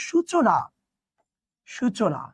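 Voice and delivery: সূচনা with a short উ, "shuchona"; pronunciation demo. Shown as a demonstration. Teacher to students, modelling সূচনা. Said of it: "তাহলে এটা উচ্চারণ হবে".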